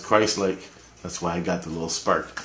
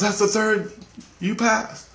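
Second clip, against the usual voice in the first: much higher voice announcing he passed; 12 semitones higher, slower than usual. Retold or imitated. Christ telling Richie he passed, much higher. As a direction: lift the pitch way up for the news.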